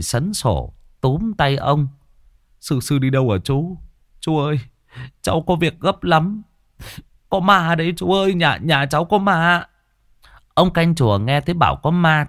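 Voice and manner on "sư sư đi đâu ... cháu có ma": high-pitched, stumbling plea; urgent frightened pleading. A narrator's reading. Man grabbing the caretaker's arm over ghosts, high frightened pleading.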